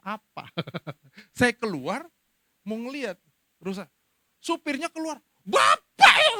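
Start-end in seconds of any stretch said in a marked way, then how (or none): 5.4-6.3 driver yelling, much higher and louder; angry shout. Retold or imitated. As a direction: blast the accusation out of the bus. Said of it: "Kok saya dimarahin?".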